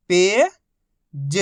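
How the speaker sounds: each syllable held, long pause between; first syllable rising, then a separate second. Shown as a demonstration. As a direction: sound it out for beginning readers. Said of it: "एक ही अक्षर का उच्चारण करके".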